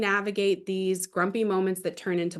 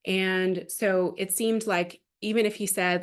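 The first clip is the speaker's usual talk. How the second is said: ordinary delivery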